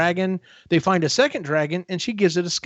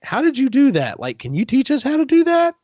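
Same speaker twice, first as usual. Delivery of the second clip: excited amazement; 10 semitones higher, 4 dB louder than usual. Retold or imitated. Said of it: "but he's like".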